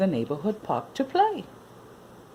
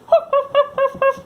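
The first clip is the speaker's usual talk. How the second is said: puppy yelp; 8 dB louder than usual. Acted. Puppy confused, playful sound.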